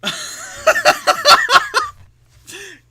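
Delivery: high, loud cackling laugh; loud burst of laughter. Real, not acted. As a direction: let a big laugh burst out.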